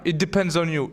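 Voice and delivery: normal talking voice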